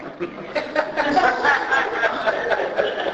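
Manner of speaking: laughing hard; long laugh. Real, not acted. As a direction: burst out laughing. Laughing at the voice-box memory.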